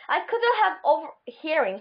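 ordinary delivery, nothing marked

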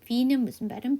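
ordinary talk, nothing marked

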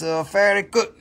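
usual speaking voice

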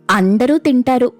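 ordinary delivery

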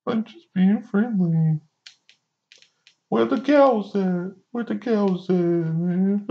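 high, slow goofy voice; mocking their excuse. T T S A excusing themselves as friendly, slow high mocking voice. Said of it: "that's when they say".

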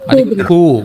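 కూ held long to show the vowel; stretched long oo. Shown as a demonstration. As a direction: draw out the కూ as a long oo. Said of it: "ఊ, ఊకి మాత్రం అనమాట".

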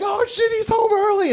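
high, startled dog voice; surprised dogs. Retold or imitated. Dogs seeing owner rush past, high startled voice. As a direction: squeal it like a surprised pup. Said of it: "they're like".

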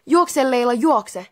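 storyteller's contained urgent yell; urgent shout. A narrator's reading. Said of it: "Tuula huusi".

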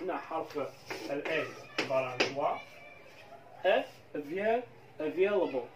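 ordinary talk, nothing marked

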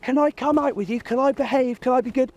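high, eager little voice begging to come out; pleading good dog. Retold or imitated. Trainer voicing a well-behaved dog asking its owner, high eager plea. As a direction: beg sweetly like a dog wanting to please. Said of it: "When they're being all like".

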